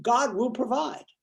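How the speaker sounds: old man refusing the helicopter, lightly voiced; stubborn old man. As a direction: wave them off, slowly and sure. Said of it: "The old man says".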